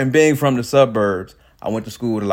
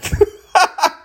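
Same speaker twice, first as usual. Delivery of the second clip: big high laugh; loud laugh; 6 dB louder than usual. Real, not acted. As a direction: burst out laughing. Laugh after the joke.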